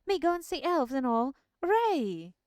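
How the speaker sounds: high, loud joyful cheer; excited shout. A narrator's reading. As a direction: cheer like a thrilled kid. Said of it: "he shouted".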